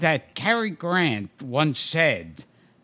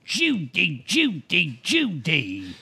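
Cary Grant impression; slower than usual. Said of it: "Cary Grant would always go".

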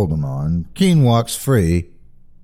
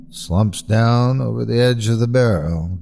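plain reading voice

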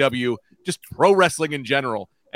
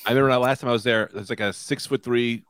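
ordinary delivery

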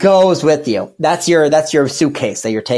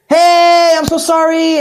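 much higher, louder bubbly apology; bubbly girl voice; 13 semitones higher, 5 dB louder, slower than usual. Retold or imitated. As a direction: pitch it way up like an overly cheerful girl. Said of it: "she sounded like that".

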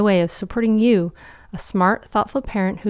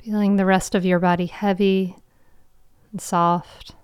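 guided meditation. Acted. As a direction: guide them gently into calm.

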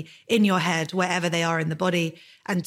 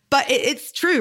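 tearful; 7 semitones higher, 4 dB louder than usual. Real, not acted. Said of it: "I can't believe I'm crying".

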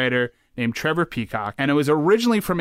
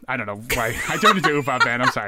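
ordinary talk, nothing marked

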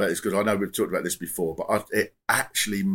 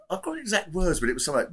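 ordinary talk

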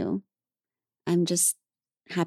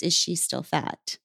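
snide aside. Retold or imitated. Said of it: "Knowing that what they meant was".

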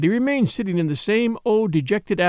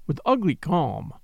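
plain reading voice

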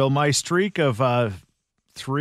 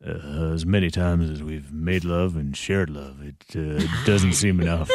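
put-on a deep, slurry, sort of drunk croon; 6 semitones lower than usual. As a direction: drop low and drag the line like a wasted crooner. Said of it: "you sound so wasted".

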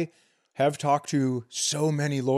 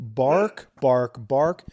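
spoken dog barks, louder, rising; mock bark; 6 dB louder, much slower than usual. Describing the guard dog, mock bark. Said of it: "He barks as if".

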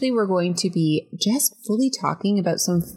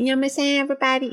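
higher, sassy shout-out; 8 semitones higher, much faster than usual. Pretend. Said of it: "she's kinda talks like".

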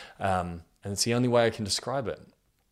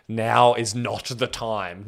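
1 mock scold; 3 semitones higher, 7 dB louder, much slower than usual. Scolding sister's cheeky question.

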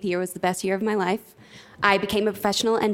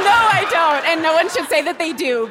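emphatic; 6 semitones higher, 5 dB louder than usual. Real, not acted. Asked about lead pipes.

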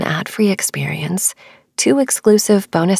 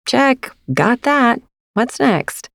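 slow, higher mock-ticking-off tone; breezy checklist voice; 6 semitones higher, slower than usual. Imitating a restless mind chasing the next goal, breezy and higher. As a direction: tick it off like a shopping list. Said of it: "It's like".

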